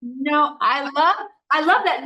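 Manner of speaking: high, loud delight; excited. Real, not acted. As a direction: burst out in delight. Excited agreement.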